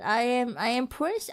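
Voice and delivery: usual speaking voice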